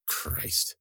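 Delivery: breathless whispered curse under her breath; muttered curse. Acted. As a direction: hiss it to yourself in disgust.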